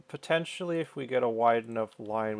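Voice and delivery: usual speaking voice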